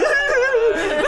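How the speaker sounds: riso agudo curto; risada aguda. Real, not acted. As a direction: solta a risada. Risada na história. (risada aguda)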